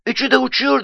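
put-on very high, excited child voice; excited boy. A narrator's reading. Nuri sees all three birds flying, excited boy. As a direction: pitch it way up like a thrilled boy.

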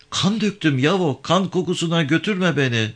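high-pitched, troubled woman's plea; pleading woman. Acted. Avke begging Yavan not to take her back, pleading woman.

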